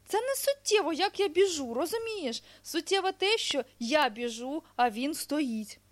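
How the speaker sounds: high, loud, emphatic storybook hedgehog; hedgehog explaining insistently. Acted. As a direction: press the point as a little hedgehog.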